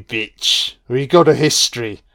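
ordinary talk

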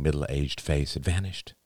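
usual speaking voice

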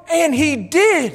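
put-on child's triumphant finish. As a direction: land it like a proud kid. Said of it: "And he said".

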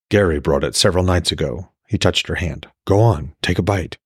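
steady storyteller reading aloud; calm novel narration; story narration. A narrator's reading. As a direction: read the chapter like an audiobook. Author reads novel aloud.

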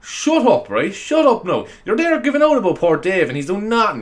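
high, loud shouted scolding; mock telling-off. Podcaster yelling at an imagined listener, mock angry shout. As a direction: snap back at the heckler, mock outrage.

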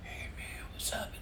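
rasped, breathy near-whisper. Retold or imitated. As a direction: imitate a raspy voice on a late-night phone call. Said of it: "I hear this raspy voice".

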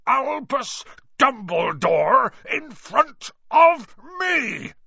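thundered. A narrator's reading. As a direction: thunder it in fury at the insult. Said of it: "he thundered".

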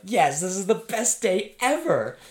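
high, loud, squealing imitation of pure joy; hammed-up best-day-ever cheer; overjoyed. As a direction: cheer like a kid who just won. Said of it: "they do it so much better than I do it".

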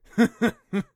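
high, loud playful laugh; teasing laugh. Acted. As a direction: laugh at the doubter. Kelpie teasing after return.

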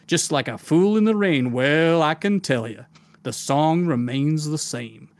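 Southern gentleman. Acted. Game master voicing his pirate captain for listeners, Southern gentleman accent. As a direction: play a silly Southern gentleman spinning a tale. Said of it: "this really kind of Southern gentleman voice".

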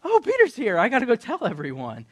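high, loud burst of excitement; squealing start, easing as she dashes off; excited girl. Retold or imitated. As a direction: blurt it out like a thrilled child. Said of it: "She's like".

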